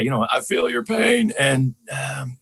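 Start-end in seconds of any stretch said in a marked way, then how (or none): 0.3-1.3 high, raspy Southern impression; Bill Clinton. Comedian quoting a former president's catchphrase, high Southern rasp. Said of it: "Bill Clinton used to say".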